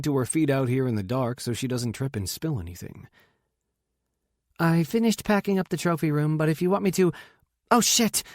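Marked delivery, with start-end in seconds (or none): 4.6-7.1 higher-pitched female character voice; busy sister. Acted. Voices sister Rachel arriving, talking. 7.7-8.2 high, loud startled swearing; sudden higher-pitched yelp of a curse. A narrator's reading. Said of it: "Rachel curses as she comes".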